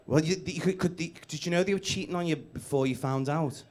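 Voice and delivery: ordinary talk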